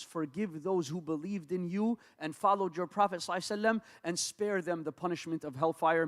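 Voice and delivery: ordinary delivery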